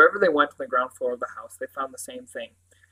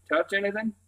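higher-pitched Cornish questioning, lifting at the end; warm Cornish accent; 6 semitones higher than usual. A narrator's reading. Sergeant asking a boy about the crime scene, Cornish accent. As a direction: question him kindly in Cornish. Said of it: "his warm Cornish accent stroking the words".

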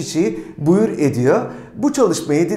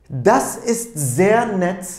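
slow, deliberate, every word clearly set apart; careful slow classroom demonstration; slow model reading; 2 semitones higher, much slower than usual. Shown as a demonstration. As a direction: model the phrase slowly for learners to copy. Teacher models the German phrase.

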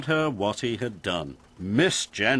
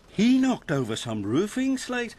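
higher, lightly voiced story character; raised-pitch telling of bad news; lightly pitched-up machine character; lightly voiced Alfie; 10 semitones higher than usual. A narrator's reading. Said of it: "said Alfie".

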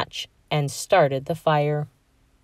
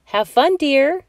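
warm mom; 9 semitones higher, 4 dB louder than usual. A narrator's reading. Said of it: "she said".

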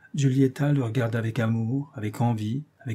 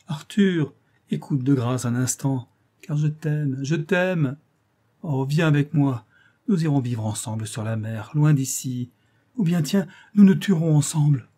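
loud, higher, begging with rising despair; pleading desperately; 4 semitones higher, 4 dB louder than usual. Acted. Girl begging her indifferent lover, loud desperate pleading. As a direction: beg him to stay, voice climbing. Giulietta begs Arthur to stay.

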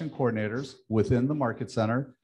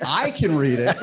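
laughing; 2 semitones higher, 8 dB louder than usual. Real, not acted. Laughing at eye surgery joke.

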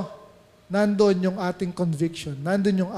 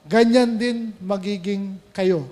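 ordinary talk

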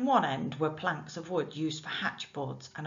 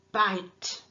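commanding; 3 semitones higher, 6 dB louder than usual. Acted. Command to dog.

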